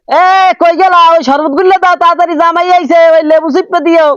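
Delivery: high-pitched urgent call into the house. Acted. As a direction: call orders to someone indoors. Calling orders into the house. (চড়া গলায় হাঁক দিয়ে তাড়া)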